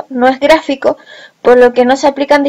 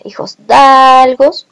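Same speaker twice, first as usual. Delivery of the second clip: "dalgos" louder, with a long stretched a; stressed A; 5 dB louder, much slower than usual. Shown as a demonstration. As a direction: lean hard on the a in "dalgos". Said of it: "última vocal acentuada la A".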